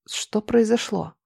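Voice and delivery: soft, slow, husky question; hoarse voice. A narrator's reading. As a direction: ask weakly, just come round. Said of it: "произнесла хриплым голосом".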